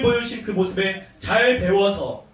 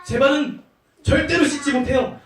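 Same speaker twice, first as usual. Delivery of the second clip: higher, louder acted protest; Peter; 6 semitones higher, 4 dB louder than usual. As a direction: refuse emphatically as the shocked disciple. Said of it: "베드로가 말했어요".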